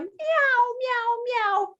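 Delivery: high, repeated kitten mews; little high cat voice; meowing tiger. Acted. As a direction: mew like a roarless tiger. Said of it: "There's the tiger going".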